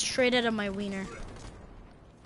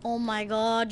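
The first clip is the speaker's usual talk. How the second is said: usual speaking voice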